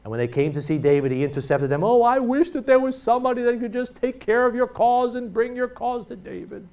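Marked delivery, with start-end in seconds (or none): 1.8-6.7 a higher, oily show of false concern; falsely humble schemer. Retold or imitated. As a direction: pretend to care while stealing the spotlight. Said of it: "All that false humility".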